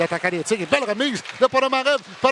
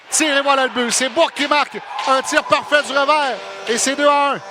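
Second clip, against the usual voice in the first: loud goal call, shouting the scorer; excited sports commentary; 4 dB louder than usual. Real, not acted. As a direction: yell the goal to the radio crowd.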